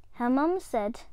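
plain reading voice

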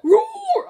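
high, loud mock tiger roar. Player voicing Tiger in a game, high loud roar. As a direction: let out a high pretend roar.

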